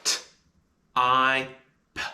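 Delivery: t, I, p said apart; sounding out type. Shown as a demonstration. Teacher sounding out type. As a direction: split "type" into three sounds. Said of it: "And the sounds of type are".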